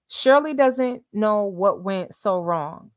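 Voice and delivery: usual speaking voice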